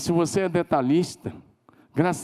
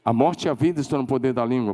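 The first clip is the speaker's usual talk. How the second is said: ordinary delivery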